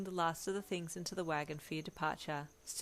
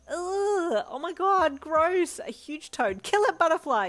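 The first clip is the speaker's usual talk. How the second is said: high, loud disgusted screech; panicked screaming, much higher and louder; 11 semitones higher, 12 dB louder, slower than usual. A narrator's reading. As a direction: shriek for help at a giant toad. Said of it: "you screeched".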